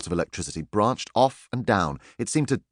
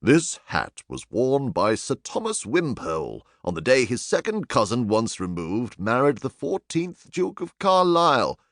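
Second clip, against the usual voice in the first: reading label aloud; 3 semitones higher than usual. A narrator's reading. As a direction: read it like a stuffy plaque.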